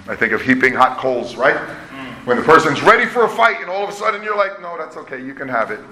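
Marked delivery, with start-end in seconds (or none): 4.6-5.8 acted quiet backing down; much softer, yielding quote; soft concession. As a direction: drop to a soft, low voice and give in. Imagined backing down from a fight.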